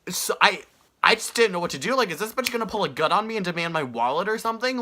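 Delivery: ordinary talk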